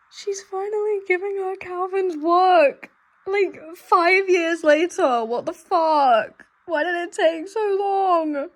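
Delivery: loud indignant wail; tearful outrage. Real, not acted. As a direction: rail at the book in tearful disbelief. Upset outburst at the book.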